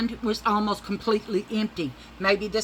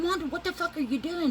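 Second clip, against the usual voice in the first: exasperated; 12 semitones higher than usual. Retold or imitated. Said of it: "I said".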